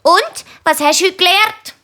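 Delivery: hohe, neugierige Mutterstimme; fragende Mami. A narrator's reading. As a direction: frag hoch nach wie eine Mami. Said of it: "will's Mami wissen". (hochi Mami-Stimm)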